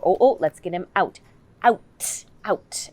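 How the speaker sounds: put-on stamp the t of "out" for a child; bouncy repeated "out"; emphasized out. Shown as a demonstration. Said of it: "You can emphasize those sounds".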